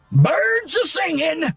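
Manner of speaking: loopy high shout; Dynamite voice. Shown as a demonstration. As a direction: stretch it out, dazed and loopy. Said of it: "in-game sounds for him".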